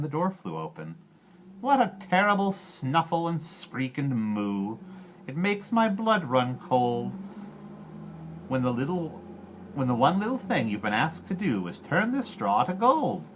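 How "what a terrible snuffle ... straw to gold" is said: put-on an odd little man teasing the crying girl; drawling imp. A narrator's reading. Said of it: "drawled the odd little person".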